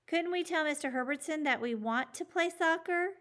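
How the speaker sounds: high, pleading question; hopeful child's plea. A narrator's reading. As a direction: ask hopefully, like a kid. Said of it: "asked Julio".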